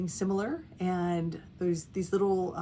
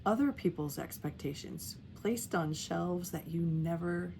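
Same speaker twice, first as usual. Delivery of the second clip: guided meditation; 5 dB quieter than usual. Real, not acted. Guiding caregivers to settle into their breath, calm meditation voice. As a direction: lead gently, letting each phrase settle before the next.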